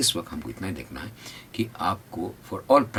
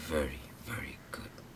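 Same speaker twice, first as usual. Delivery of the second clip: whispered praise; 2 semitones lower, 10 dB quieter, much slower than usual. Real, not acted. Praising students softly.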